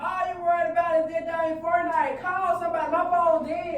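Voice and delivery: yelling in a panic; angry shout. Real, not acted. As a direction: snap at him to call for help. Panicked cousin scolds brother.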